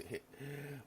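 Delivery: soft, high acted giggle; laugh. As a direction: titter softly and high. Character laughs about trying booze.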